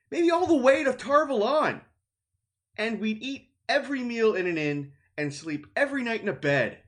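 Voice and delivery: pushing his friend to sell the ruby. A narrator's reading. Mat argues during read-aloud.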